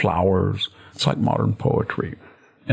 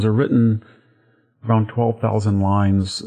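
normal talking voice

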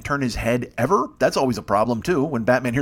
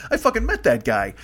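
ordinary talk, nothing marked

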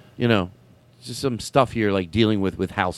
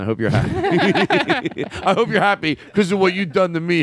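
high-pitched mock grievance; half-laughing fake accusation; mock resentment; 7 semitones higher than usual. Mock rant at dead friend Harris.

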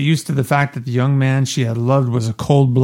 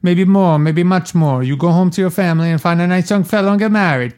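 put-on higher, broken-English Italian accent, quick at the end; 6 semitones higher than usual. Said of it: "I'm using this accent 'cause Puzo wrote".

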